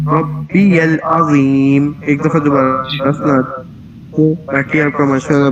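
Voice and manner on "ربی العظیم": ربی kept short, slow and even; short rabbi. Shown as a demonstration. Teacher correcting the student's long ربی. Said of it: "ٹھیک ہے؟".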